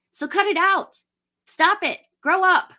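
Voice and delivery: annoyed scolding. Real, not acted. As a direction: tell them off like you've had enough. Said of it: "I'm getting annoyed".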